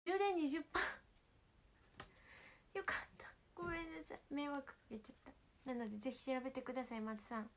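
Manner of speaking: ordinary talk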